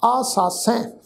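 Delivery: ਆਸਾਸੈ with a nasalised final vowel; ਆਸਾਸੈ ending nasal; nasal pronunciation. Shown as a demonstration. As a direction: let the end of ਆਸਾਸੈ ring through the nose. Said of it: "ਨਾਸਕੀ tone ਵਿੱਚ ਪਾਠ ਉਚਾਰਨ ਕਰਾਂਗੇ".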